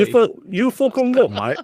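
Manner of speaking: very high mock Australian cheer; Australian accent, joking cartoon bloke; squeaky hammed-up Australian. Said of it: "Australian accent".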